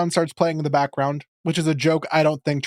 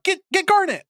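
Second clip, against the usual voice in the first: put-on much higher, panicky kid voice; panicky Steven. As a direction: squeak out a frantic plea. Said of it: "he's like".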